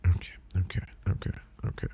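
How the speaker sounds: very soft repeated whispered okays; imitated whispering. Girl reassuring herself on the train, imitated whisper. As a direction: whisper it under the breath, imitating her.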